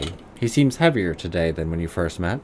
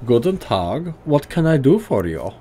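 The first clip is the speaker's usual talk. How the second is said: put-on gruff German accent, pitched higher; 7 semitones higher, 3 dB louder than usual. Old tinkerer greeting the player in a higher gruff German accent. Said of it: "heavily accented German tonality".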